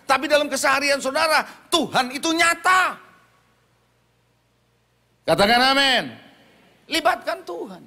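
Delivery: full-force preaching. Real, not acted. Preacher telling the congregation God is real, forceful and rising. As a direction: drive it home and call for an amen.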